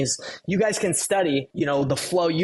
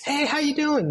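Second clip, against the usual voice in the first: bright, much higher cheerful hello; smiling greeting; 11 semitones higher than usual. Retold or imitated. Caller greeting you with a smile, upbeat and bright. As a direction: greet them like you're beaming. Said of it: "They're smiling".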